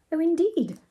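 Ethel's voice. A narrator's reading. Ethel replies about Bernard, lightly voiced high reply. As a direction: lift the pitch a little for her.

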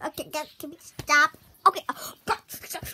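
ordinary talk

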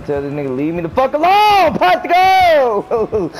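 exasperated plea stretched into a shout; building shout. Real, not acted. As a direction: start near normal, end yelling. Being chased in game.

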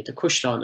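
Cushlon with U as in "cushion"; Cushlon, first vowel U, not A; local pronunciation. Shown as a demonstration. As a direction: say Cushlon as "KUUSH-lawn". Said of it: "is pronounced the Cushlon locally".

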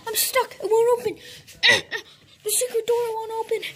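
a pretend trapped kid crying. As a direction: whimper that the door is stuck. Trapped behind secret door.

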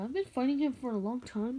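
usual speaking voice

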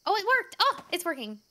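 high delighted burst; excited. Real, not acted. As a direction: cheer as it works. Puzzle step succeeds.

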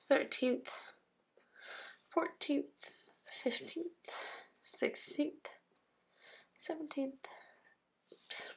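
soft, half-whispered counting to yourself; under the breath. Real, not acted. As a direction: count off softly, with long gaps. Counting dates while writing them in.

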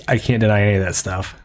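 normal talking voice

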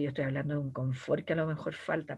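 normal talking voice

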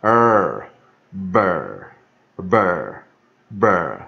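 "er" then "ber", each loud and separate; emphatic teacher's model of "ber"; syllable drill. Shown as a demonstration. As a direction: drill the ending "ber" with a held r-coloured vowel. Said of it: "也就这个符号哈".

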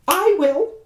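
high, bright monkey call; monkey volunteering, high and keen. Acted. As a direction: chirp it like an eager monkey. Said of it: "the monkey said".

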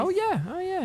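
high, strained oh yeah; high squeaky exclamation. Real, not acted. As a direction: squeak it out tensely. Frustrated aiming in game.

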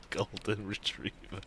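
soft, slow, low savouring; chuckling repeat. Real, not acted. Player relishing the dog joke, quietly amused. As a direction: murmur GOLDEN RETRIEVER through leftover chuckles.

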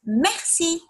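teacher modelling Merci in a high voice for pupils; French merci, high. Shown as a demonstration. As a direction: model Merci brightly for the class to echo. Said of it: "can you say merci".

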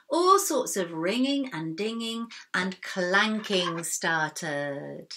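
usual speaking voice

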